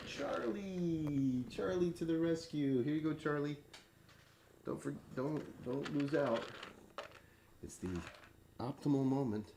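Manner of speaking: high soft coaxing pet talk; coaxing the cat. Real, not acted. Owner coaxes Charlie to take his treat, high and soft. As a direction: coax the cat gently, up high.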